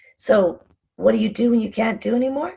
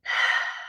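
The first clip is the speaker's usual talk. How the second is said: unvoiced breathy sigh; sigh of breath; 5 dB quieter than usual. Shown as a demonstration. Showing viewers how simple it is, a relieved sigh. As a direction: let out an audible breath. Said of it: "it's as simple as the breath".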